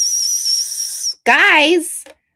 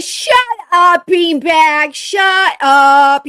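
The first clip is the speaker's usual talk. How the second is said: loud teasing shut up; mock-scolding a viewer; playful yell; 5 semitones higher, 11 dB louder, much slower than usual. Real, not acted. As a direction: yell at the beanbag joker. Yelling at chat user.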